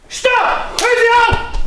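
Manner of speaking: high, loud shouted command. Shown as a demonstration. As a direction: scream it out to stop him. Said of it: "Schrei, sei laut".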